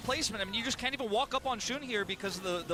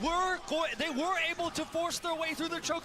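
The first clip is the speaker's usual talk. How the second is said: energetic play-by-play, pitched up; esports caster; 8 semitones higher than usual. Acted. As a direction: call the fight like a live caster. Live play-by-play of teamfight.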